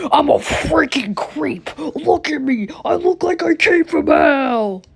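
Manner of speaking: high, slow creepy demon voice; demonic voice. Acted. Sonic tells Amy he looks like he came from hell, in a demonic voice. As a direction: creep it out like a possessed toy. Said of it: "In the same demonic voice, deep voice".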